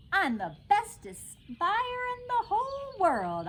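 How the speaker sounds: high, slow, dreamy boast to herself; little girl gloating inside her head; proud inner voice. A narrator's reading. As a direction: savour the boast privately, drawn out. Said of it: "I said inside my head".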